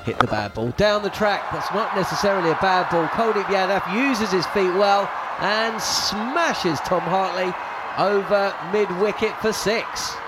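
ordinary talk, nothing marked

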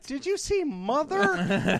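high, slow, gushing fan; mock fan voice. Mimicking a confused fan asking about Mother, high and slow. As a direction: ask it like a starstruck fan, drawn out. Mocking a fan's question.

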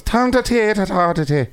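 high, hammy Irish gibberish; sing-song mock Gaelic chatter; fake Gaelic babble. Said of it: "Irish character that's all he does is goes".

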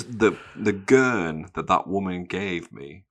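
usual speaking voice

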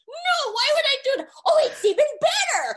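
high, loud, hammed-up outburst; heated retold exchange. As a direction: burst out shrieking as you act both sides. Acted protest and gleeful reply, retold.

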